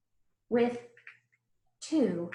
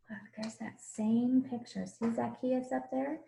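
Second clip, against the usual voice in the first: soft hushed aside; whisper; 6 dB quieter than usual. Real, not acted. Teacher pointing out the picture to children, whispering. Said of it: "whispering to children about picture".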